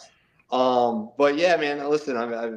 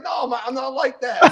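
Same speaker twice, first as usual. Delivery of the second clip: higher, louder indignant protest; upset denial; 10 semitones higher, 6 dB louder than usual. Retold or imitated. Annoyed at being called Jersey Shore, higher louder protest. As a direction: push back hard, offended. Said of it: "And I used to get upset".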